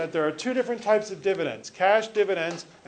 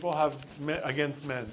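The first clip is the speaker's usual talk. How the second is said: normal talking voice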